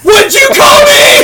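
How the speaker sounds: high, loud, slow indignant yell; outraged shout. Retold or imitated. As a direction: bellow it back in disbelief. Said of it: "And you're like".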